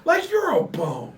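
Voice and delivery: mocking. Real, not acted. Mocking insult.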